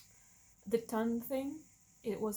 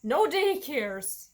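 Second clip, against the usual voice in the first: slow and louder, each word stamped; firm vow; 3 semitones higher, 9 dB louder, much slower than usual. Retold or imitated. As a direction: declare it like a rule. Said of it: "No nannies".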